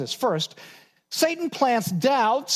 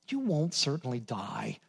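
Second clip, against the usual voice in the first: sly serpent; 7 semitones lower, 7 dB quieter than usual. Acted. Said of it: "the serpent said to the woman".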